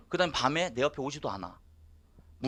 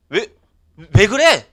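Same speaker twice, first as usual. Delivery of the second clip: 따지는 외침; 4 semitones higher, 13 dB louder, much slower than usual. Retold or imitated. 아내에게 따지는 부부 싸움.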